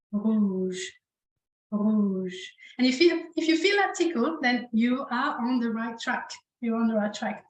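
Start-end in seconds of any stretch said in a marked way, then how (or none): 0.1-2.3 "rouge" with a light R tickling the throat; French throat R. Shown as a demonstration. Teacher has learners feel their throat, subtle throaty R in "rouge". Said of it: "when you make the R sound".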